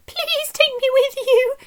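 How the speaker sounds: a high, begging little harp; pleading harp. A narrator's reading. Harp begging Jack at the doorway, high and pleading. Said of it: "said the harp".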